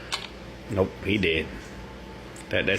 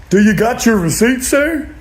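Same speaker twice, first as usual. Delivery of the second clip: store clerk; 9 semitones higher than usual. Retold or imitated. Returns clerk asking the customer, a hint of cashier voice. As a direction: ask like a bored cashier. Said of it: "standing in line ... return it".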